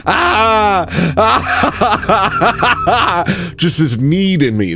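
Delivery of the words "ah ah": high yelling cry with a laugh; anguished cry. Real, not acted. Streamer craving nicotine cries out, half laughing. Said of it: "I hate this".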